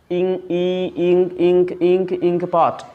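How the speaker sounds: इंक with a clear ई at the start, slowly; repeated इंक, stressing its ई; sound demo. Shown as a demonstration. Teacher letting students hear the ई in इंक. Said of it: "ई आ रहा है".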